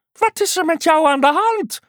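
very high, quick question; inquisitive frog. A narrator's reading. Frog asking the unicorn what's wrong, high and quick. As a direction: fire it off as an eager frog.

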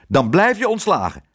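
angry boss laying down the law. A narrator's reading. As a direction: deliver it as a cross threat. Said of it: "zei meneer Pardoes kwaad".

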